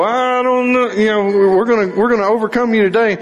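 taunting bills. Pretend. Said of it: "the bills go".

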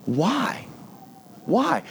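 high, imploring cry at modest volume; plaintive questioning. Acted. Believers asking God about failure, plaintive. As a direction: plead upward in mock bewilderment.

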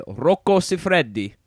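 slow Italian "Rocco Siffredi" with rolled r and doubled d; carefully Italian "Rocco Siffredi", each syllable stretched; Italian pronunciation. Real, not acted. Host introducing his guest, saying "Rocco Siffredi" slowly the Italian way. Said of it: "you say this pretty, pretty good".

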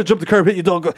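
ordinary talk, nothing marked

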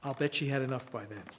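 ordinary talk, nothing marked

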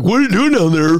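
high, nasal-free squeaky giraffe. Pretend. Friends joking, giraffe calls down in high voice. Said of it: "I think giraffe would be the dumbest one".

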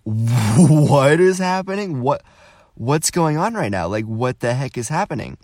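high, loud mock disbelief, easing toward the end; retold bewilderment. Whole group watches a stranger's confrontation, hammed-up bewilderment.

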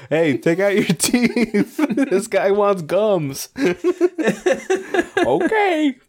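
high, slow hammy call-out; high comic server voice. Acted. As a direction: call to the back in a silly high voice. Acted server calling out.

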